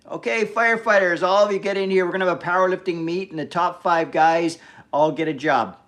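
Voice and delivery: hammed-up boss addressing a room of recruits; mock announcer call. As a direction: call everyone in like a drill boss. Acts boss calling firefighters to tryout.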